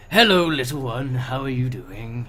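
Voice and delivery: put-on creepy insincere old man. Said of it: "creepy old man smile, very insincere".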